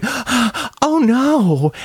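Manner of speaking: mock gasping woman. Imitating the woman reacting to the family vanishing. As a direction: gasp out a horrified oh no. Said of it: "she starts going".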